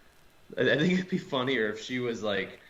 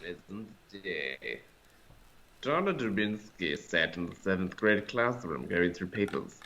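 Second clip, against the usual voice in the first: narrator voice; 2 semitones lower, 3 dB quieter than usual. Shown as a demonstration. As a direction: read it like a creepy nature documentary. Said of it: "This is my narrator voice".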